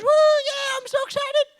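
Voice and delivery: very high, loud imitated cheer; hammed-up falsetto thrill; sister's excited squeal. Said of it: "Then my sister goes".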